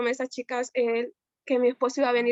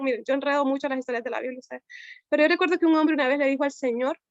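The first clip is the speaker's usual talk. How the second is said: usual speaking voice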